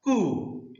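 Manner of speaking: कु with a lengthened oo, pitched high; long vowel. Shown as a demonstration. Teacher shows how long oo is pronounced, long vowel. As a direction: hold the oo in कु a little longer. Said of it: "इस प्रकार से उच्चारण किया जाता है".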